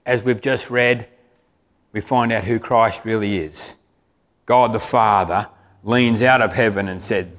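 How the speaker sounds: usual speaking voice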